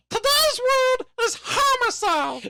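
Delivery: slow, very high puppet announcement; Elmo. Acted. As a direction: teach the word in a squeaky puppet voice. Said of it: "Now we're gonna do Elmo".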